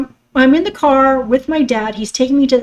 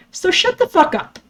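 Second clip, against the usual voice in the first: telling off; 7 semitones higher than usual. Real, not acted. Telling off stepmother.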